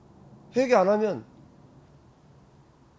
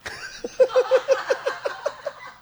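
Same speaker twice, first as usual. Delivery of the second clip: burst of high laughter. Real, not acted. Preacher laughing at her own shouted demo, high laugh. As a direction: laugh out, delighted with yourself.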